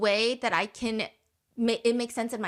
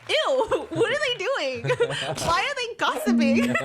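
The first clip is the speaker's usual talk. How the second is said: high-pitched, laughing squeal of distaste; mock disgust; 10 semitones higher, 3 dB louder than usual. Girlies in the comments seeing guys gossip, mock disgusted. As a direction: imitate scandalized commenters, hammed-up and high.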